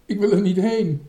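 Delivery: loud, high protesting wail; high-pitched, full-voiced whine; frightened child's cry. A narrator's reading. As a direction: cry out in refusal like a scared child. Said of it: "riep Toontje".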